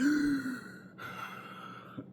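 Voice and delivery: long, high gasping drag on a cigarette; imitated sharp inhale. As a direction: suck the air in like slurping a milkshake. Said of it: "the way he inhales, he's like".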